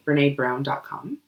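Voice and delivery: ordinary delivery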